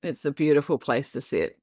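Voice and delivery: real emotion breaking into the voice; choking up while trying to carry on; welling up, struggling to keep talking; getting emotional. Real, not acted. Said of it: "that's gonna make me emotional".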